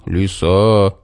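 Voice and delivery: lightly voiced bear, drawn-out call. A narrator's reading. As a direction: call for her attention. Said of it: "снова позвал медведь".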